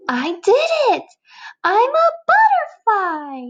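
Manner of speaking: high, thrilled shout of success; triumphant butterfly. Acted. New butterfly announcing it to his friend, high and thrilled. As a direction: burst out with joy at the transformation. Caterpillar voiced in read story.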